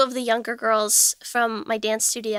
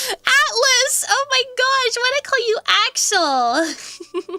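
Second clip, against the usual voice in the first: much higher and laughing, embarrassed; laughing at own slip; 11 semitones higher, slower than usual. Real, not acted. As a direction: laugh off getting the name wrong. Corrects viewer's name, laughing.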